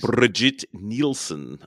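drawn-out name in a French accent. Shown as a demonstration. Co-host obliging a request for the actress's name, French accent. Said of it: "say with your French accent".

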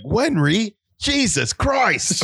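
high, drawn-out mock outcry; exasperated dad impression. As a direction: play an appalled father crying out slowly. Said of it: "he's gonna be like".

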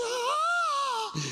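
high, mock-frightened yelp; wife's half-laughing frightened shriek; scared cry. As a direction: imitate the wife's startled squeal. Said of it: "Mi esposa decía".

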